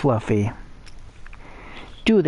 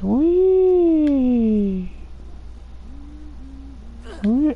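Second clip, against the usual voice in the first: gleeful whee; 11 semitones higher than usual. Real, not acted. Jumping around like an idiot.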